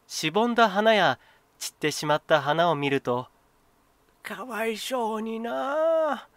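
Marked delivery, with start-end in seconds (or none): 4.2-6.3 put-on high, slow, grieving old man's sigh; an old man mourning his wilted flowers, high and slow. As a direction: let it fall away like a sorrowful sigh. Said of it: "ため息をついて涙をこぼします".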